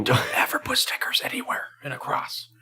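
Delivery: hushed whispered warning. Retold or imitated. Warning the creepy kid about crosses, whispered. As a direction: whisper it like you're afraid she'll hear.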